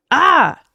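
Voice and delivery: high, loud falling scream; sudden high yell; full-voiced startling shout. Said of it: "came the shout".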